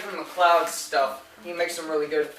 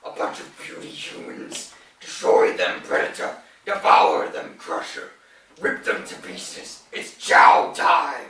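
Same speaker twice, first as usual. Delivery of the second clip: ogre voice; slower than usual. Acted. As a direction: bellow like a hungry ogre. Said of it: "I thought I did pretty good with the ogre voice".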